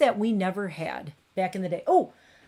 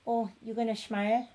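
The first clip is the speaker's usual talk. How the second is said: cooing; 3 semitones higher than usual. Real, not acted. Cooing at cat.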